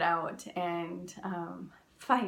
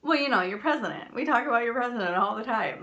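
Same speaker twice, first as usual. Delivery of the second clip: louder and fast, explaining eagerly; eager stranger; 2 semitones higher, 6 dB louder, faster than usual. Retold or imitated. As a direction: rush to explain it's the president. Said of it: "And he was like".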